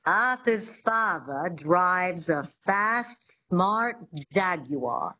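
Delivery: slow transatlantic, no final Rs; transatlantic accent. Shown as a demonstration. Coach models practice sentence, slow transatlantic accent. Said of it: "sentence I thought we could play with".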